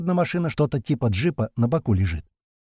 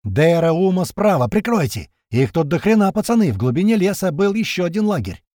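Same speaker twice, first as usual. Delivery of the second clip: panicked under fire; 6 semitones higher, 5 dB louder than usual. A narrator's reading.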